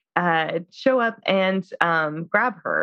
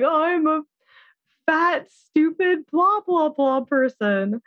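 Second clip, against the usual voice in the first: much higher mock whine, slow on fat stupid; self-pitying wife; 10 semitones higher, slower than usual. Imitating the wife moaning about herself after his stories. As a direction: whine it like a put-upon housewife. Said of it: "she's constantly like".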